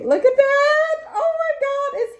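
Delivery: high, loud squeal of delight. Real, not acted. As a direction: squeal at the cute card. Excited gasp over gift card.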